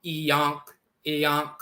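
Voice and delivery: iyonk as ee-yonk, nasal onk; pronounced iyonk. Shown as a demonstration. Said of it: "becomes pronounced yonk".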